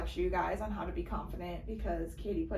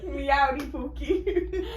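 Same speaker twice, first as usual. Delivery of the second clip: slow mock country accent, higher, laughing; 4 semitones higher, 5 dB louder, much slower than usual. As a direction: draw it out in a jokey country accent. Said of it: "like, a country accent".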